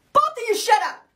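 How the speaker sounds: high, sharp telling-off; acted fed-up outburst; exasperated scolding. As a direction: put an end to the squabble. Third person breaks up squabble.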